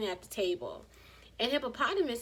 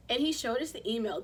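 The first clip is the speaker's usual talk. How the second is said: usual speaking voice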